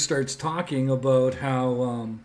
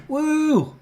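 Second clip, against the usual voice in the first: high, happy woo; real cheer; 5 dB louder than usual. Real, not acted. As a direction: celebrate landing it. Got the item in.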